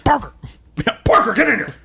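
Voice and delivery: high, loud barking boss; J. Jonah Jameson. Retold or imitated. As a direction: shout like a gruff newspaper boss. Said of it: "Mister Jonas Jameson".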